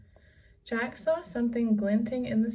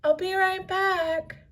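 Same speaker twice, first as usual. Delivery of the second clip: higher, louder call over the beach; 10 semitones higher, 5 dB louder than usual. A narrator's reading. Jack calls to Annie while running off, raised voice. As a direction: call back as you run. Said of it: "Jack called".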